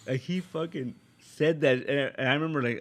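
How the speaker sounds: usual speaking voice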